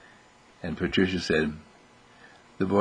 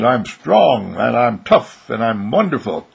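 put-on loud, emphatic boyish self-praise; proud boy; 6 dB louder than usual. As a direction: declare each virtue with force. Arnold proudly quotes his voice.

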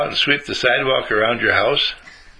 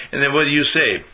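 usual speaking voice

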